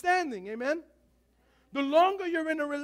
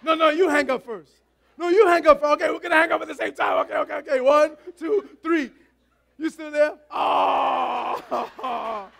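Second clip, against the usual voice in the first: put-on lovestruck teen couple on the phone; giggly late night call; 6 dB louder than usual. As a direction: coo and tease, neither hangs up. Couple stalling hanging up.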